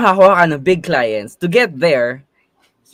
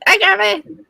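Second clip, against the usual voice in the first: much higher playful cartoon voice; high squeaky voice; slower than usual. Repeats greeting in character voice.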